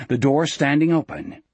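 usual speaking voice

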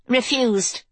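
sharp curt refusal; snapped. Acted. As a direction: snap it shut. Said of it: "Kelsey snapped".

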